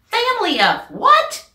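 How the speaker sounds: high, loud disbelieving question; can't believe what he heard; surprised. Acted. Clancy at headquarters questioning Michael, loud surprise. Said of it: "Clancy said".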